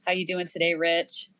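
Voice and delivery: normal talking voice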